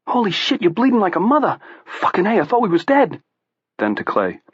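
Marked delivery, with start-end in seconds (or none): 0.1-3.2 high, excited South Boston jabber; squeaky Boston sidekick voice; Southie accent. A narrator's reading.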